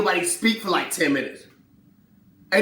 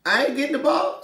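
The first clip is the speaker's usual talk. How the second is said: indignant Pippen; 10 semitones higher, 6 dB louder than usual. Retold or imitated. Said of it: "He's like".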